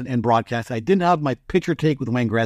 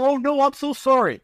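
high, flustered apology; imitating his own flustered panic; panicked apology; 9 semitones higher than usual. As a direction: apologise in mock horror. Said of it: "I'm thinking".

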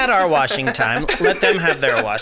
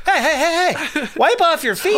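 much higher, fussy scolding voice; fussy prop master; 13 semitones higher than usual. Pretend. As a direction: fret like a stagehand guarding a brand-new set. Imagined prop master scolding guests.

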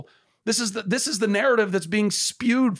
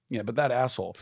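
sarcastic sneer; 6 semitones lower, 4 dB quieter than usual. Pretend. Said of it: "have this".

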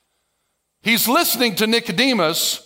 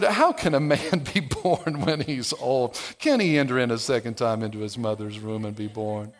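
condescending; 9 semitones lower, 6 dB quieter than usual. Retold or imitated. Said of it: "starts to be a little bit condescending".

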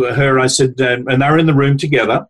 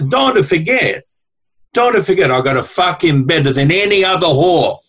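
higher, mildly hammed-up Italian old woman accent; lightly voiced Italian grandmother; 3 semitones higher than usual. Retelling what the elderly Italian wife said, higher and lightly accented. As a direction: play the little Italian wife letting you in on a secret, a light Italian lilt. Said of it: "little square Italian woman".

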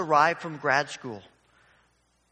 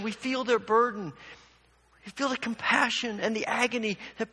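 higher, earnest pulpit exhortation; preaching; 5 semitones higher than usual. Acted. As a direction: urge the congregation with rising conviction. Preaching the application.

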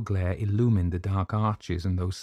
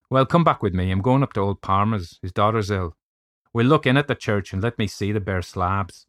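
louder, hearty Irish doctor voice; brisk Irish doctor; 6 dB louder than usual. Acted. Doctor urging the narrator back to church, brisk Irish voice. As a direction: play the Irish doctor taking charge.

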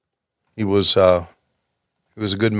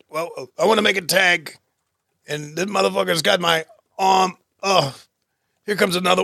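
higher, whiny small-guy inner monologue; voicing the wrestler; 5 semitones higher than usual. Commentator voices the wrestler's thoughts during the hold in a high, whiny voice. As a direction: grumble like a tired little wrestler stuck in a hold. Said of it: "what I'm thinking Bill Dundee's thinking is".

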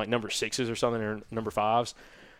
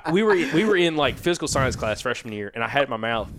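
normal talking voice

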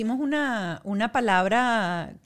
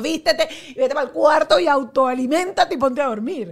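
hammed-up fed-up mom, louder and higher, laughing through it; exasperated mock scolding; 5 semitones higher, 7 dB louder than usual. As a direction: blow up at the kid half joking, with a laugh. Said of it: "lo que me provocaba es decirle". (regaño exasperado de mamá, medio en broma y entre risas)

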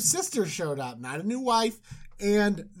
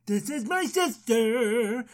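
slow, drawn-out parody of an introduction; mocking imitation; 2 semitones higher, slower than usual. Abimelech quotes Abraham to God, mocking imitation. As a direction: stretch each word to mimic the liar. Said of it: "He said it just like that".

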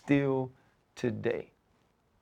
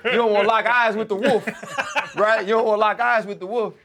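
laughing; 8 semitones higher, 5 dB louder than usual. Real, not acted. Laughing about avoiding eye contact.

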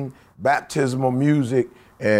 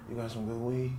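mumbled quote; 4 semitones lower, 15 dB quieter, faster than usual. Retold or imitated. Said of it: "all I know was I heard".

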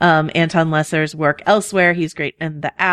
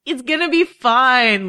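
higher, slow and sweet reassurance; Margaery reassuring; 8 semitones higher, slower than usual. Retold or imitated. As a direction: coax her gently like an older friend. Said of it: "Marjorie is there to tell her".

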